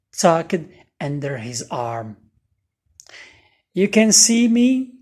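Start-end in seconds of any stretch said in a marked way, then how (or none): 3.7-4.9 higher and louder, startled question; surprised. A narrator's reading. Ghost amazed that the boy can see him, surprised. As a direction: ask like you can't believe it. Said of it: "the ghost asked, surprised".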